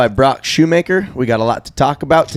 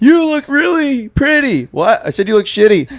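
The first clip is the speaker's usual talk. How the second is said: much higher, cracking mock voice; voice-crack imitation; 12 semitones higher than usual. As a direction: yell it with a mock pubescent crack. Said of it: "Did your voice just crack?".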